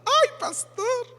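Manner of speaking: very high, slow, sing-song gush; mock the sister's delighted cry. Imitating the church sister greeting the pastor, high and drawn out.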